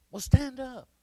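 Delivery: high, loud exasperated retort; exasperated friend barking an order; impatient. Retold or imitated. Impatient retold reply.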